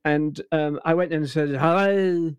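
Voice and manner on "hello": David Frost voice. Shown as a demonstration. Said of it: "I did David Frost".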